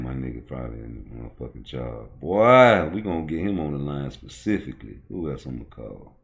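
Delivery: usual speaking voice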